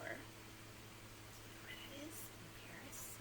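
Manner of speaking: soft whisper. Real, not acted. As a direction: hush it like a secret. Quiz question to kids.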